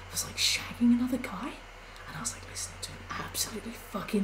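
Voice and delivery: hushed whisper, gossiping. Real, not acted. Whispering the neighbours' drama to chat. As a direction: keep it to a breathy secret. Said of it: "whispering gossip to chat".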